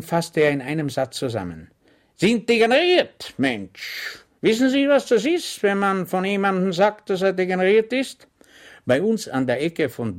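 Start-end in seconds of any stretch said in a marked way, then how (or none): 2.1-8.3 much higher, louder outburst; exasperated officer. Acted. The lieutenant exasperated at Schwejk, loud. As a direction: blow up at the fool.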